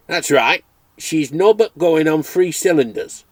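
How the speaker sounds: a cheerful Dales farmer calling at the door; Yorkshire farmer. Acted. Farmer continues.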